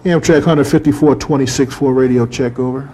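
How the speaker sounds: loud, crisp radio operator call. Real, not acted. Operator making a radio check.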